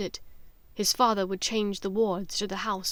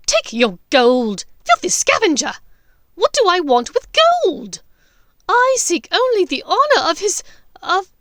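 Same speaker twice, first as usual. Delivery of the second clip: much higher, loud contemptuous witch; Bellatrix; 9 semitones higher, 13 dB louder than usual. Acted. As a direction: spit at the scavenger, high and loud. Voices Bellatrix.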